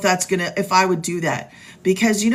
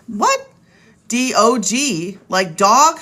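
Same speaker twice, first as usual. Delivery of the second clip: surprised what; 2 semitones higher, slower than usual. Real, not acted. Vlogger puzzled by a viewer's name, startled. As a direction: caught off guard by the name, asking what.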